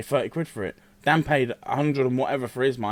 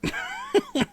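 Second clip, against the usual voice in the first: laughing; 13 semitones higher, slower than usual. Real, not acted. Joking laugh scene.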